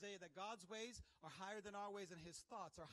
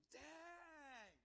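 high, softer, long-drawn exclamation; amazed exclamation; 8 semitones higher, 8 dB quieter than usual. Real, not acted. Preacher amazed at the sea obeying, awed exclamation. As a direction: stretch it out in amazement.

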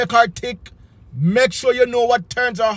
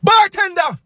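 calling the bartender; 7 semitones higher than usual. Retold or imitated.

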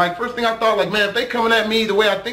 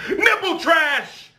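mock curse; 6 semitones higher, slower than usual. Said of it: "most creative curse words".

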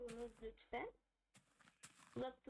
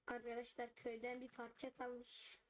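ordinary delivery

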